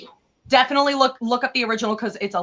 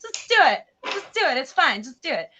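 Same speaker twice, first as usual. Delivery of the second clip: high, loud mock cheerleading, fading off; sarcastic glee; 8 semitones higher, 5 dB louder than usual. Real, not acted. Urging the mermaid to stab the prince, sarcastic high glee. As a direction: egg her on with gleeful sarcasm.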